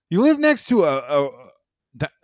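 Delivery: high, loud disbelieving cry; a loud, high, incredulous yell at mom; incredulous outburst. Retold or imitated. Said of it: "I'm like".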